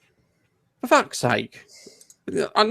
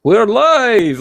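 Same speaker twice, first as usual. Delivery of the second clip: bursting onto the mic, higher and louder; excited; 10 semitones higher, 7 dB louder than usual. Real, not acted. Host opening the live show to viewers, excited.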